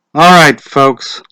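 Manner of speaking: a grave official addressing the crowd; slow, solemn call to the crowd; Mr. Summers' voice. A narrator's reading.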